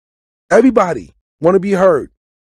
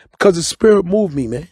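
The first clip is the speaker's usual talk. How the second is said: normal talking voice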